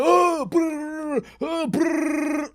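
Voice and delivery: high mock scream with a trilled brrr; imitated screaming. Storyteller mimicking men screaming in the yard, mock scream. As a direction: imitate a high, rolling holler. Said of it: "So I hear man screaming".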